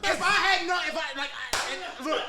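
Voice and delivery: loud, high, laughing out the words; too amused to finish; sputtering through laughter; laughing hard. Real, not acted. Listener laughing, struggling to speak.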